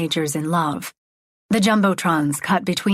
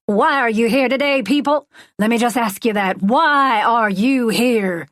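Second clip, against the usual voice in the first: raised, stretched-out preacher voice; revival preaching; 4 semitones higher, 3 dB louder than usual. Acted. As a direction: preach it to the whole stadium. Preacher questions crowd.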